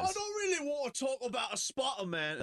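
high, put-on British whine; fake British accent. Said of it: "speaking of fake Brits".

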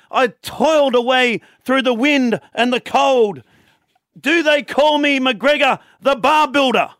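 put-on slow, high old Irish publican; old Irish barman boasting, drawn out; Irish accent. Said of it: "says in his thick Irish accent".

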